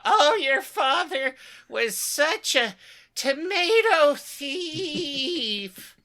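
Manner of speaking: high, silly mock voice; mocking quote. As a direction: tease him in a put-on squeaky voice. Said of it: "calling, talking about".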